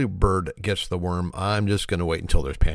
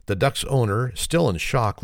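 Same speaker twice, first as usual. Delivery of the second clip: ordinary delivery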